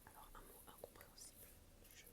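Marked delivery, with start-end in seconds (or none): none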